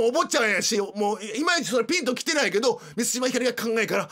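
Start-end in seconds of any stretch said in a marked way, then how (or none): none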